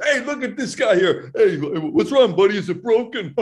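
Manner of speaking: high, loud teasing jeer from a stranger; mocking guys at the urinal. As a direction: needle him like a rude guy in the restroom. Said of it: "people say".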